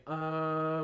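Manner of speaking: "uh," held out like someone trying to remember; hesitating schwa. Shown as a demonstration. Teacher showing a memory trick, "uh," vocalized as a drawn-out hesitation. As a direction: draw out a thinking "uh," on one level note. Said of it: "try really hard to remember".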